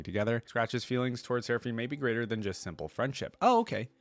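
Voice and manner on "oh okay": high, louder burst of surprise; surprised reaction. Real, not acted. Reader reacting to a hint of romance, surprised. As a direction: jump up in pitch, caught by surprise. Reacting to crush revelation while reading.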